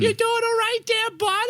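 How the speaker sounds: put-on high-pitched Maine local. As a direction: imitate a Maine buddy in a high falsetto. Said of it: "would do this voice".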